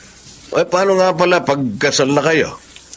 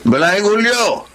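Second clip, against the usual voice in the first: slow, drawn-out hail; calling to his in-law down the road; 6 semitones higher, slower than usual. Acted. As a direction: call across the street. Calling out to passerby.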